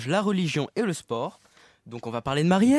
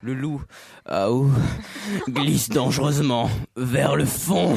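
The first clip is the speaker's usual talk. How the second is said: mock drama; 4 semitones lower, slower than usual. Mock dramatic sports news.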